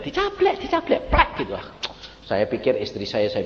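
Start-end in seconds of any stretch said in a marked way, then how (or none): 0.0-1.3 high, playful repeated slap words; mock slap sound. Preacher to church, acting the slap high and playful. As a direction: tap it out high and teasing. Slapped in sleep, retold.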